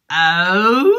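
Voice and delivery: long loud howl climbing in pitch; howl from low to high. Shown as a demonstration. Puppet repeats the pitches for the class, climbing howl. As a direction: swoop up into a wolf howl. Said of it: "They go from low to high".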